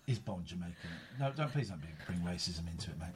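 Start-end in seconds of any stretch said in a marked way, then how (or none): none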